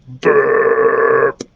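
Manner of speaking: long rolling imitated burp; rumbling mock belch; dragon belch. A narrator's reading. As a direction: trill out a big burp. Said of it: "Dribble let out a belch".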